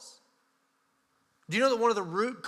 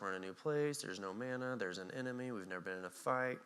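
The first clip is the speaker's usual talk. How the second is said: weary Joshua; 7 semitones lower, 13 dB quieter than usual. Retold or imitated. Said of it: "and he's like".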